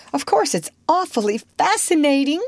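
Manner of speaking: high, eager exclamation; excited cry. A narrator's reading. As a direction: give in with keen enthusiasm. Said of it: "she cried".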